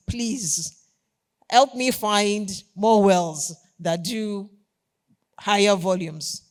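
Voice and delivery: earnest plea. Retold or imitated. Retold plea to president.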